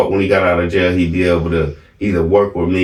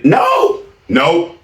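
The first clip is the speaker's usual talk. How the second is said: ordinary delivery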